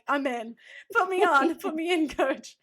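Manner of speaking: mock eager plea to the coach; excited yes. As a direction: sell it like a benchwarmer begging to play. Eager acceptance retold.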